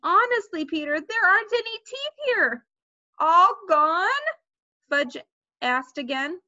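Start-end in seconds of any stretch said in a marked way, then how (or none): 0.0-2.7 put-on high, pleading girlish protest; mild exasperation. A narrator's reading. Sheila searches the ground in vain, mildly exasperated. 3.2-4.1 put-on high, loud small-child question; little boy. A narrator's reading. Fudge asks again about his teeth, little boy.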